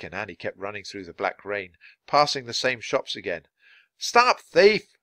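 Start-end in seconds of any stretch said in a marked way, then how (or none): 4.0-5.0 high, loud street shout; yelling. Acted. Two boys in pursuit, yelling after the runaway. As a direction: raise the alarm, pitch well up. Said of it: "Both boys were yelling now".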